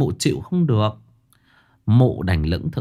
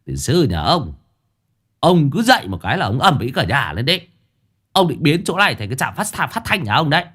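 higher, fast exasperated scolding; snapping; 6 semitones higher than usual. Acted. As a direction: snap at the noisy drunk. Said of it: "cậu gắt".

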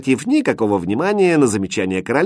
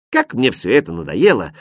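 ordinary delivery

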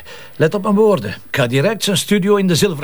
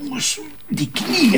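high, slow cry of pain; pained groan; 12 semitones higher, slower than usual. Real, not acted. Inspector hurting his swollen knee, pained high groan. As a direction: squeeze the words out through knee pain.

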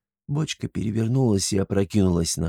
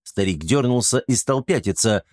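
ordinary delivery, nothing marked

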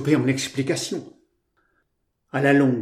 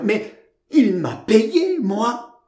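high, drawn-out naive protest; slow, high-pitched plaintive exclamation; naive tearful cry; 9 semitones higher, 3 dB louder, much slower than usual. A narrator's reading. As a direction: cry out innocently, near tears. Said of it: "cria naïvement Georgette, les yeux en larmes".

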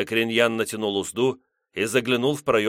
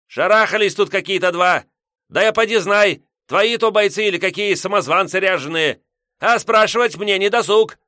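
put-on grumpy old man; 11 semitones higher, 7 dB louder than usual. Headman yelling.

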